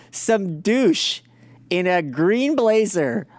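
ordinary talk

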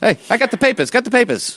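high newsboy hawking papers; newsboy call. Acted. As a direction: cry out papers like a street newsboy. Newsboy calling out.

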